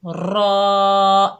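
रॉ slow, full-mouthed, voice lifting; rounded, rising रॉ; trilled रॉ; heavy raw. Shown as a demonstration. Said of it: "इसकी आवाज़ ऊपर को उठेगी".

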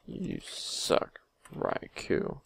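breathy whisper, no voice at all; whispered insult. Real, not acted. Gamer whispering at the useless pet, a quiet whispered jab. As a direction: hiss it under your breath at the pet. Pet bails during fight.

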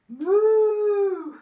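long, loud high moo; big swooping cow noise. Pretend. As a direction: imitate a cow for children. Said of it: "she makes a noise like this".

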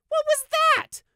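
very high, loud spitting voice; spat out. Acted. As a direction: spit the words like they burn. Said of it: "Prey spat out".

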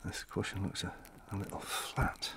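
breathless whisper over her chair. Acted. Widower whispering beside his late wife's empty chair. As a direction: hush it, as if she might hear.

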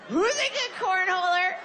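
put-on high, loud cooing baby talk. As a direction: coo it out, over the top. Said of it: "I can't turn it off".

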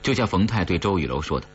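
plain reading voice

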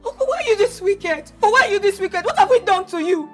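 distraught accusations in a rush; wailing, indignant rant; tearful rant. Acted. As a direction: rail at your uncle through tears. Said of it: "When you are done ranting".

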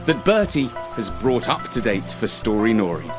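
ordinary delivery

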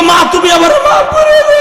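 slow, high tearful plea to mother; pleading child. Acted. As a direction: beg your mother's forgiveness, drawn out. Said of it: "বলো". (মায়ের কাছে কাঁদো কাঁদো ধীর মিনতি)

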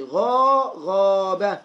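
غا drawn out, then غابة with a long first vowel; chant-like teacher's model of غا، غابة. Shown as a demonstration. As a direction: stretch the aa in غا and غابة. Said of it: "ألف يعني فتحة طويلة".

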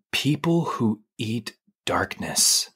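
slow, hushed spooky prophecy fading to a whisper; ominous child quote. Retold or imitated. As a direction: intone it like a dark omen, slowly. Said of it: "And he goes".

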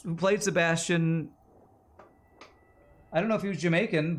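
normal talking voice

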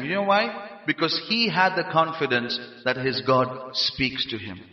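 usual speaking voice

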